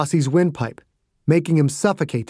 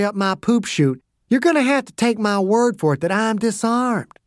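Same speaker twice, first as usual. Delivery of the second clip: higher, mocking tough-guy voice all through; cocky taunting old soldier; 5 semitones higher than usual. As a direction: needle him, daring him to swing. Dew taunts Perry.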